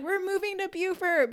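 high-pitched, giddy announcement; excited. Retold or imitated. Couple celebrating the move to Beaufort, excited. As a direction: retell thrilled news, pitched well up. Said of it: "we were so excited".

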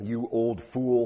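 slow, drawn-out scornful jeer; scoffing. Acted. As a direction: sneer it out like a mocking villager. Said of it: "they scoffed".

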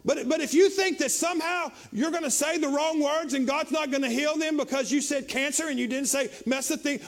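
ordinary talk

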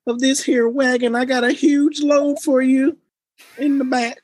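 high, loud hillbilly holler. Acted. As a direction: belt it out like a hillbilly, pausing before the last phrase. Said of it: "That was my hillbilly, uh, voice".

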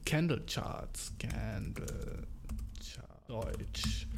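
soft unvoiced mumble searching for word; muttering to himself. Real, not acted. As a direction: whisper it while thinking.

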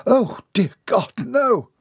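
high, drawn-out cry of dismay; horrified dismay. A narrator's reading. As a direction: gasp it out high and slow in dread. Put her hand to her heart.

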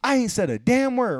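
high, loud acted protest; indignant retort. Customer protesting to the window girl, indignant. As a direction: jump up in pitch, loudly mock-offended. Acts out arguing with window worker.

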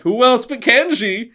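high, sing-out show-title delivery; mock TV show chorus; sitcom tagline. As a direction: belt out the catchphrase cheesily.

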